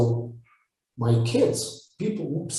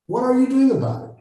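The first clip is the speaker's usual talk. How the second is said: pushing challenge; 10 semitones higher, faster than usual. Retold or imitated. Coach confronting a complainer, repeating the question much higher. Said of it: "I said".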